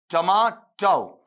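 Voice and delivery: "टमाटो" very slow, tuh-maa, then tau; syllables split apart. Shown as a demonstration. Teacher separating syllables for students, slow "tuh-maa-tau". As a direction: break "टमाटो" in two, ending on an ah-oo glide. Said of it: "इसे अलग करो".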